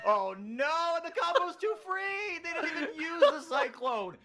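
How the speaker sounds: excited play-by-play. Acted. Caster calling the teamfight to stream viewers, excited high loud play-by-play. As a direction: ride the action like a shoutcaster at a big teamfight.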